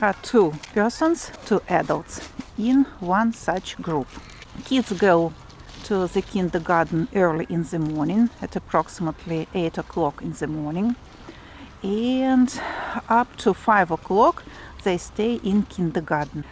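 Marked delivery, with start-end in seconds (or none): none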